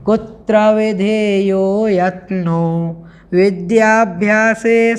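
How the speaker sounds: slow, measured chant of the Sanskrit verse; slow verse recitation. Shown as a demonstration. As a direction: intone each word slowly and carefully. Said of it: "आइए सर्वप्रथम इसका हम उच्चारण करेंगे".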